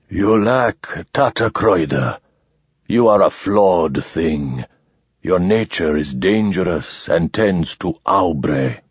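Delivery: low, slow, rusty and arrogant; harsh arrogant alien. Acted. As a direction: pronounce judgment like a cold machine. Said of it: "The arrogance of the harsh, unused voice".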